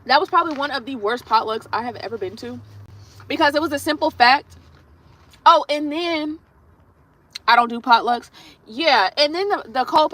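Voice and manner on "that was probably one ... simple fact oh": ordinary delivery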